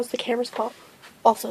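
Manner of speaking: ordinary talk, nothing marked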